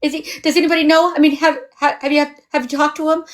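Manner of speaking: mock a scared, tearful caller firing off questions; mimicked crying panic. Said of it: "she was crying".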